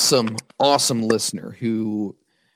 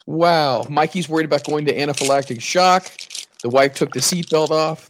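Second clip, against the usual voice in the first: ordinary talk, nothing marked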